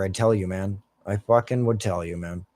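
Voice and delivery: ordinary delivery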